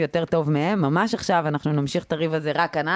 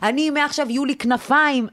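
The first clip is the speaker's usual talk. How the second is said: higher-pitched mock rant of a mother; retold mother's screaming; 6 semitones higher than usual. Daughter retelling her mother's fights, mock screaming. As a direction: imitate mum ranting, without real volume. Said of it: "והיא צורחת דברים".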